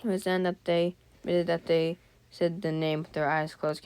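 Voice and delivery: ordinary talk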